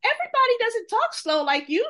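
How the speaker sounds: high, indignant comeback; sassy high-pitched reply; indignant retort. Retold or imitated. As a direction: fire back high and offended. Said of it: "And I'm like".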